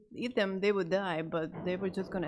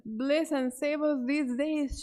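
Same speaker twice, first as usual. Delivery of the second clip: high, loud, slow cry of alarm; mother sees her children on the roof, alarmed; 6 semitones higher, 4 dB louder, slower than usual. Retold or imitated. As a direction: gasp it out like a frightened mother. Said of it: "and the mom says".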